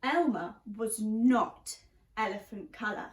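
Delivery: ordinary talk, nothing marked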